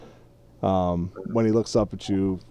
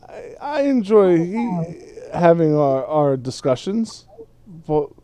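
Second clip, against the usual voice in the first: higher-pitched, halting hobbit voice; hesitant tipsy hobbit; 7 semitones higher, 3 dB louder than usual. Acted. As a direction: pick your words haltingly, voice raised. Game master plays tipsy Frodo.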